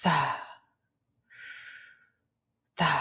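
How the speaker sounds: "Da" breathed out on a sigh; "Da" with a heavy release of breath. Shown as a demonstration. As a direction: sigh out "Da", tongue relaxed. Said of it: "while you're sighing".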